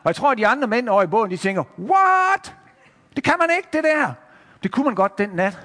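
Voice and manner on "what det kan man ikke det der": high, drawn-out incredulous "what"; high-pitched acted amazement; astonished disbelief. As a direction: react in amazed protest. Men in boat astonished.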